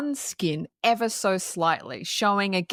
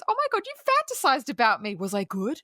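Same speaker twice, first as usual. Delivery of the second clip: high, fast, playful mock of a smug friend; lightly voiced Lily, high and quick; teasing Lily; 5 semitones higher, faster than usual. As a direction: tease her as if it's a joke, pitched up high. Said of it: "She goes".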